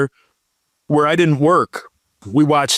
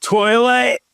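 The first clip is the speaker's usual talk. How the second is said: Scottish accent; 7 semitones higher than usual. Shown as a demonstration. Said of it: "say it in a Scottish accent".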